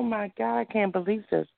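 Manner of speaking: ordinary talk, nothing marked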